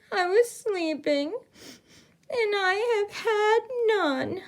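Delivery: wailing bear. Acted. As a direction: whimper it out like a left-out bear. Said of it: "he whimpers and he moans and he wails".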